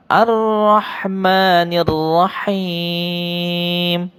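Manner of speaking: slow chanted tilawah; Quran recitation. Shown as a demonstration. Teacher chants the verse for pupils to repeat. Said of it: "Pak Guru ulang".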